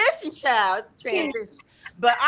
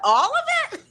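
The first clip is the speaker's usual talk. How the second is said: mock shocked "All of it?" jumping way up high; shocked question; 4 dB louder than usual. Wife imitating her husband's shock at cutting it all off, pitch shooting up.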